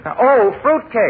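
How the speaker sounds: slow, high drawn-out groan of dismay; dismayed realisation. Acted. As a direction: let the disappointment sink in slowly. Guest realises he dislikes fruitcake.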